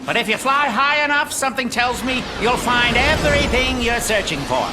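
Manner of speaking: normal talking voice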